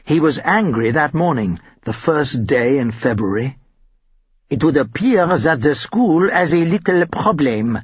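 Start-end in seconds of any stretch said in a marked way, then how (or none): 4.5-7.8 thick hammed-up French accent; exaggerated French accent. A narrator's reading. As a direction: play the French teacher's heavy French accent. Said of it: "in his exaggerated French accent".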